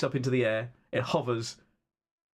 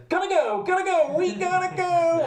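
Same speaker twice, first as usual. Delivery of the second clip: very high, sustained robot dog yelp; excited robot pup urging everyone on, high-pitched; Buddy; 4 dB louder than usual. Acted. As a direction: squeal it out, high and insistent. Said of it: "Buddy says".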